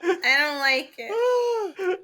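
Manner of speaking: slow, drawn-out fake crying whine; mock whimper. Friend whimpering at the absurd Bowser casting, mock crying. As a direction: whimper it like a wounded fan.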